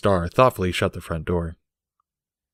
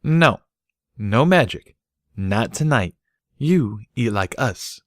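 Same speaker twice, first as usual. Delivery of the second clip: firm mare; 4 semitones higher, slower than usual. A narrator's reading. Mare firmly telling Bucky no magic. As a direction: forbid it flatly.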